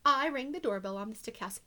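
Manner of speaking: sly wolf narrator, hushed then punchy; storybook reading. A narrator's reading. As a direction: tell it to children as the wolf, confiding and playful. Continues the wolf's story.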